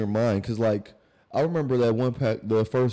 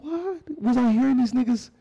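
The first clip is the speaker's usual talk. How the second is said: confused; 13 semitones higher than usual. Retold or imitated. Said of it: "I'm like".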